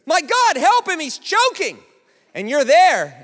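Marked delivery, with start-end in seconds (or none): 0.1-1.8 high, loud, slow alarmed plea; panicked cry for help. Acted. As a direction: yell in alarm that someone is choking. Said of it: "the manager at Boost Juice goes".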